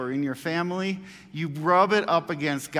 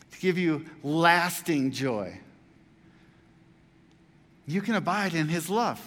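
high, loud, joyful encouragement; joyful Christ; 4 semitones higher, 3 dB louder than usual. Acted. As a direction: cheer the disciples up with rising joy. Voicing Christ cheering up disciples.

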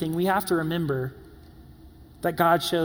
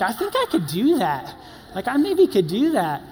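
comic high-pitched wavering; 6 semitones higher, 4 dB louder than usual. Retold or imitated. Man warming to a fanny pack, high comic wavering. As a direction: pitch swooping up high in mock self-persuasion.

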